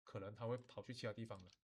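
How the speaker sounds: ordinary delivery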